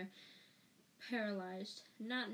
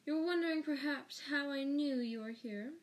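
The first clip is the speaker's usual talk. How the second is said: higher, louder, gloating delivery; suppressed triumph; 5 semitones higher, 5 dB louder than usual. A narrator's reading. Snape gloats to Lupin at catching him, suppressed triumph. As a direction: raise the voice as if savoring a victory. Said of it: "full of suppressed triumph".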